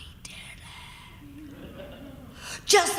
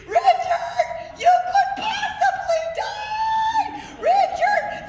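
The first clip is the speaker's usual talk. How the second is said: very high, loud laughing scream; 5 dB louder, slower than usual. Retold or imitated. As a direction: shriek his name in mock panic. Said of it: "we were screaming".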